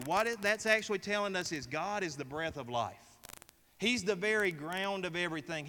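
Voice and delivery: normal talking voice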